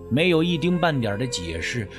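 usual speaking voice

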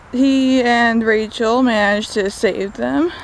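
ordinary delivery

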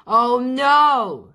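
high, loud cry; shout. A narrator's reading. Biff cries out as car goes down mountain, high shout. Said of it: "shouted Biff".

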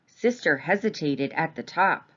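ordinary delivery, nothing marked

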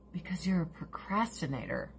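whispered aside; whispered mocking dig; whispered jab. Real, not acted. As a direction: hush it like a secret dig.

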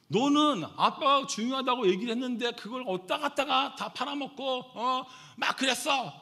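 loud, high-pitched father's rant; heated retold outburst; angry scolding. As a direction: re-enact telling off your daughter. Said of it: "화가 나는 거예요".

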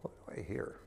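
hushed whispered question. Real, not acted. Preacher quietly prompting the congregation to answer, whispered.

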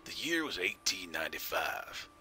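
slow Southern storyteller drawl; Southern accent. Pretend. Streamer opening his character's bio for chat, Southern accent. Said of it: "my southern accent".

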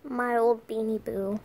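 normal talking voice

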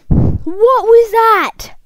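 high, loud and drawn out slowly; baffled outcry. Real, not acted. Player reacting to a glitch, baffled outcry. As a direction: stretch out the question in loud disbelief.

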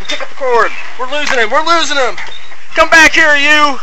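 high, loud chase shouting; excited shouting. Pretend. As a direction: yell while chasing someone. Reporter chasing runaway subject.

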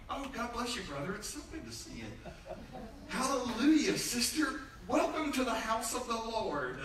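sugary overdone church welcome, high; fake church greeting. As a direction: greet them sweetly as if nothing happened. Mock churchy greetings after fight.

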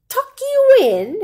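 high, loud, slow disbelief; surprised pirate. A narrator's reading.

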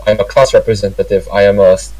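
ordinary talk, nothing marked

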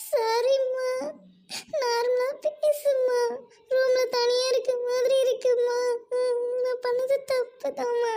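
choked-up apology to an angry mother; crying plea. Acted. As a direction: beg her mother through sobs. Daughter pleads with angry mother.

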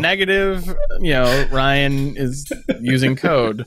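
slow mock machine readout; computer voice. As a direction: pretend to read code aloud, drawn out. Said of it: "like, computer voice".

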